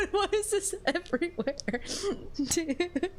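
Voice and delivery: laughing. Real, not acted. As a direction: laugh through the question. Laughs at the meme.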